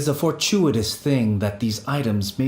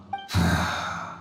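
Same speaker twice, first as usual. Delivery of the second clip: heavy sigh; 5 semitones lower, 4 dB quieter than usual. Acted. Spirit grieves over friend's suffering.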